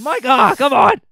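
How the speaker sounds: loud, high exasperated yell at a friend; annoyed shout. Real, not acted. Streamer yelling at Mike for spraying smoke, exasperated shout. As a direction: shout him down, fed up.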